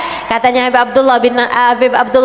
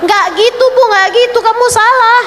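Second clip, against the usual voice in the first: scolding correction; 8 semitones higher than usual. Acted. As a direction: call them out firmly. Correcting the wrong way. (menegur dengan suara tinggi)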